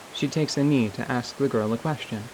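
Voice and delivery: ordinary talk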